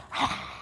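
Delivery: a forceful breathed ha, tongue out; breathy lion's pose ha. Shown as a demonstration. Instructor demonstrating lion's pose, a breathed ha. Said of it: "open our eyes wide, and go".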